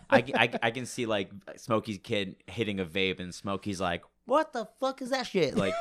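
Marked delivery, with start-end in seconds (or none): 4.2-5.5 high-pitched squawking tough guy; accidental mobster impression, pitched way up; Joe Pesci. Podcaster voicing Smokey at a vape, squeaky Joe Pesci. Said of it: "felt like I sound like Joe Pesci".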